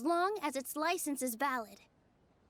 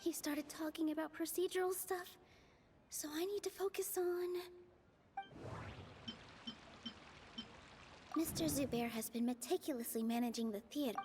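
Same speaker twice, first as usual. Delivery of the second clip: softer, half-whispered thinking to herself; quiet inner thought; 8 dB quieter than usual. Acted. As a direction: murmur the thought so nobody hears. Private thought, whispered aside.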